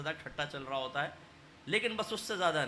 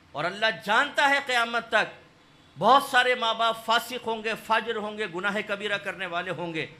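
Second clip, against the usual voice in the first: loud, higher fiery sermon voice, pressing each phrase; fiery preaching; 3 semitones higher, 9 dB louder than usual. Acted. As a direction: thunder it at the congregation. Preaching at full force. (پرجوش خطیبانہ انداز میں بلند آواز)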